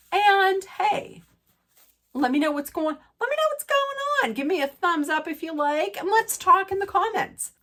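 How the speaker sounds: high, bright outro to the viewers; sing-song goodbye, pitch way up; bubbly sign-off. Acted. Vlogger signing off to viewers, high bubbly outro.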